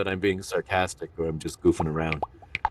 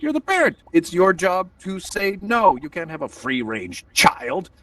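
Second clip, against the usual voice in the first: exasperated scolding; 8 semitones higher than usual. Retold or imitated. Parent lectures lax mom about saying no, exasperated.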